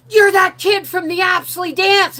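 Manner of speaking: high, loud, slow declaration; excited realisation. Retold or imitated. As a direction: announce the discovery, thrilled. Excited recognition.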